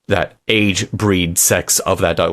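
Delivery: ordinary talk, nothing marked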